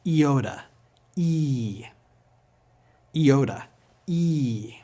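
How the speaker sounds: "Iota" as "ee-OH-ta", then a long "eee"; simple E sound. Shown as a demonstration. Teacher leads repetition, ee-sound iota. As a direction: open "Iota" on ee, hold "E" alone. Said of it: "It has the simple E sound".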